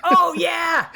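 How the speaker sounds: high, loud laughing burst; real laughing outburst at the joke; laughing shout. Real, not acted. Laughing at the air humping joke.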